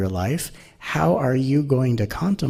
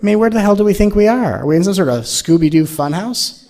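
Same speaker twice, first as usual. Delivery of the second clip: louder, higher and fast, incredulous; exasperated outburst; 10 semitones higher, 7 dB louder than usual. Real, not acted. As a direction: throw the question at the room in disbelief.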